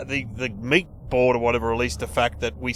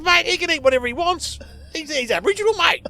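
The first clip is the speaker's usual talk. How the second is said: high, loud, excitable talkback caller; hammed-up caller, voice raised high; caller impression; 3 dB louder than usual. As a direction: pitch it up like an over-keen radio caller. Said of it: "he sounded like one of your code".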